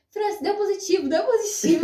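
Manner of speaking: high, breaking into laughter; telling mother-in-law the test is positive, laughing nervously. Retold or imitated. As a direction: blurt the news half laughing. Blurting the positive test result.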